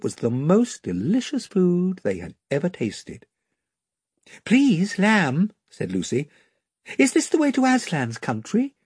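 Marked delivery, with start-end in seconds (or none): 4.4-8.7 put-on higher, eager young girl asking; a hopeful girl's question; Lucy asks the lamb the way, eager and high. A narrator's reading.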